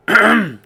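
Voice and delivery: loud, pointed throat-clear. A narrator's reading. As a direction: ahem for attention. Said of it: "cleared her throat".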